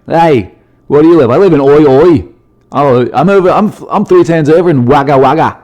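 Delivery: loud, high hammed-up Aussie accent; Australian accent. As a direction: call out like a cartoon Australian. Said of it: "I don't know how good that accent is".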